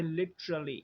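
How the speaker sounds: literally as li-chruh-lee; chr sound. Shown as a demonstration. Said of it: "टा और रा थे मिलके". (literally में ट-र मिलाकर "च्र")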